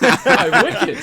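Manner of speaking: high, loud laughing cry; laughing exclamation. Real, not acted. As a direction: burst out laughing at the ruling. Reacting to the joke ruling.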